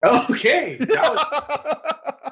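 high, breaking into laughter; can't hold back the laugh. Real, not acted. Cracking up at awkward transition.

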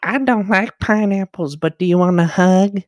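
very high put-on child voice; other kid's baby voice. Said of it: "the other kid's like".

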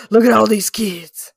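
big booming announcer voice; loud announcer. Pretend. Mock spotlight announcement.

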